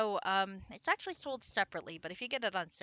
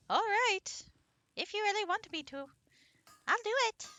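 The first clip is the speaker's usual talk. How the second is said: pixie voice; 11 semitones higher than usual. Acted. Streamer answering the dwarf in a high pixie voice. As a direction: chirp it up like a tiny pixie. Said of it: "do a pixie voice".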